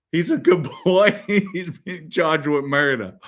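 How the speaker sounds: high, amused mock disbelief; laughing mimicry. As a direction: imitate onlookers incredulously, breaking into laughter. Mocking grandmother's line, laughing.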